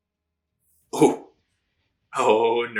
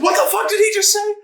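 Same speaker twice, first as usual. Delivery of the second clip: high, loud, fast disbelief; mock shocked outcry; 4 dB louder, faster than usual. Viewer voicing everyone reacting to the insult, high mock-shocked shout. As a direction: voice the room's stunned outrage, pitched way up.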